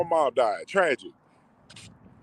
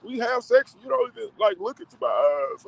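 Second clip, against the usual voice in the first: put-on girlfriend complaining; 8 semitones higher than usual. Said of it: "she was like".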